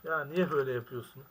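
frustrated yell. Real, not acted. Gamer yelling at stuck game.

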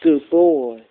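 normal talking voice

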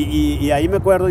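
ordinary talk, nothing marked

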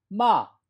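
마 starting high, falling sharply, loud; fourth tone. Shown as a demonstration. Teacher models tone four for students, loud falling 마. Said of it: "사 성".

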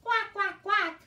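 high quacking duck sound. Shown as a demonstration. Teacher showing kids how a duck quacks. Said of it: "They go".

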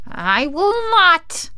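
high, slow witch voice; drawn-out, high-pitched witchy refusal; witch's retort. A narrator's reading. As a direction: refuse defiantly as a wicked witch. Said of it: "retorted the witch".

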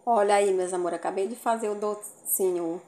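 ordinary delivery

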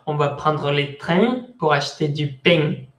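joking Marseille accent, ng on train and pain; southern French with sounded nasal endings. Shown as a demonstration. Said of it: "en el sur va".

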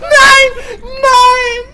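high screaming no, very loud; terrified scream. Real, not acted. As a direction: scream in real panic. Caught by monster, screaming.

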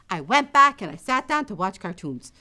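plain reading voice

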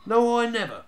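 high, loud indignant "no"; loud denial. A narrator's reading. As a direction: insist hard you never did it. Youngest denies to brother.

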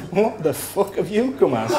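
blurted through a laugh; laughing mock insult. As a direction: snort it out, half laughing. [name] mocking the Sheriff.

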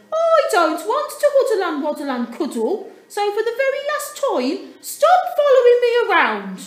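angry Dudley. A narrator's reading. Dudley yells at his siblings to go. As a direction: shout the refusal, fed up.

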